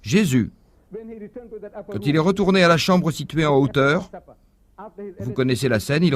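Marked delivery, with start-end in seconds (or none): none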